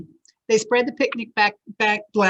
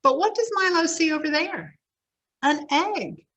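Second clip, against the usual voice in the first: ordinary delivery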